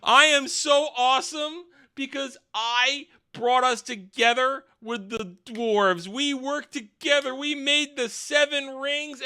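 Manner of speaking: high-pitched, bombastic self-praise; mock big speech. Celebrimbor giving a big speech, hammed-up high oratory. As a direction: declaim it high, like a pompous rally speaker. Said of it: "giving a big old speech".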